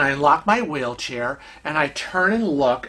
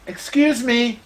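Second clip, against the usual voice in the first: polite first attempt; 6 semitones higher, slower than usual. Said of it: "the third time".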